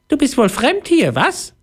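very high, tiny mouse voice; squeaky little voice. A narrator's reading. Mouse to a newcomer behind her, high squeaky voice. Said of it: "ein piepsendes Stimmchen".

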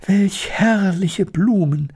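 put-on high, slow delighted girl's cry; Elli calls out. A narrator's reading. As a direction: marvel at the flowers, drawn out. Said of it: "rief Elli".

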